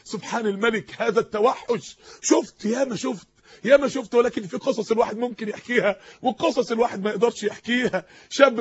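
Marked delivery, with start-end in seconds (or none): none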